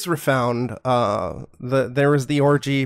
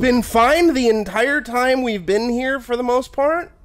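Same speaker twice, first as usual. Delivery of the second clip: squeaky, pitched-up complaint; high squeaky voice; 8 semitones higher than usual. Real, not acted.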